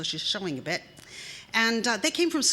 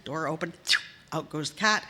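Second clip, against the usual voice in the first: ordinary delivery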